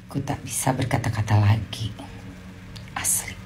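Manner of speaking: breathless whisper of disbelief. Real, not acted. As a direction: whisper it, lost for words. Speechless whisper.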